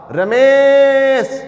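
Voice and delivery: calling far across a distance, Ramesh stretched long and loud; long drawn-out call. Shown as a demonstration. Teacher demonstrating a long vowel by calling out to someone far away. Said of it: "जिसको हम बहुत तेज, अ, आवाज़ दें".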